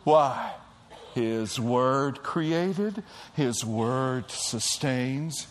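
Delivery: hushed slow proclamation; slow and soft. Real, not acted. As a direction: lay each word down slowly. Slow emphatic soft preaching.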